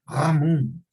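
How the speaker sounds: "Ramón" with a throaty gh for the r; r of "Ramón" as a guttural gh; distorted r. Shown as a demonstration. Teacher demoing distortion: "Ramón" with guttural r. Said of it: "la R no está pronunciándose correctamente".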